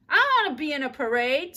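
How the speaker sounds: high, loud chanting chorus; screaming. A narrator's reading. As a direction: kids yelling in unison. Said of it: "screamed the children".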